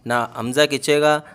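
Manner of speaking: normal talking voice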